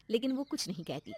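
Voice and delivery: ordinary delivery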